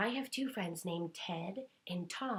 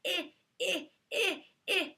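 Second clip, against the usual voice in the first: high, squeaky eh eh, higher each time; itchy eh sounds; 10 semitones higher, 4 dB louder than usual. Shown as a demonstration. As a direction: squeal eh as if the eyes itch. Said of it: "it sounded like eh".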